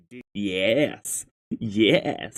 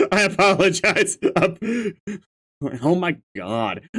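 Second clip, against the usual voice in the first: ordinary talk, nothing marked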